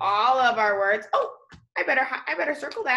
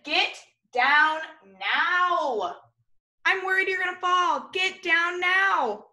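slow, stretched, rising worry; teacher worried the child will fall; worried firm order; 5 semitones higher, slower than usual. Shown as a demonstration. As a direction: warn a child who might fall. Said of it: "Yeah, I said".

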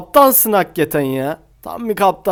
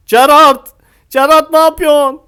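exasperated shout; 7 semitones higher, 5 dB louder than usual. Real, not acted. Shouting at player.

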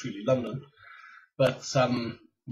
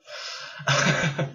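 short excited burst of laughter; happy laugh; 5 semitones higher, 4 dB louder than usual. Real, not acted. Gushing over a favourite Pokemon, happy laugh. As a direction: laugh out loud, getting a bit happy. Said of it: "Get a bit happy".

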